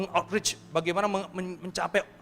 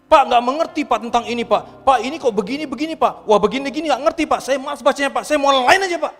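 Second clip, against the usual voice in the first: loud whining complaint; 9 semitones higher, 10 dB louder than usual. Retold or imitated. Complaining reader voiced loudly.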